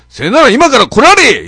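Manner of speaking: a fed-up Okayama-dialect bark down the phone; angry Okayama dialect. Retold or imitated. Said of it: "岡山弁でちょっとキレ気味で言うて". (岡山弁でキレ気味に怒鳴る)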